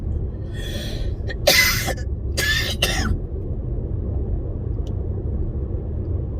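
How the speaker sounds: a big loud sneeze. Real, not acted. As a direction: let a sneeze burst out. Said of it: "J'étais un peu fort".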